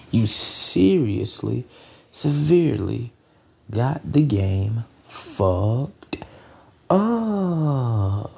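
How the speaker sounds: very slow, drawn-out words; emphatic repetition. Real, not acted. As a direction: stretch each word, dragging out the last. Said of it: "say it just one more time".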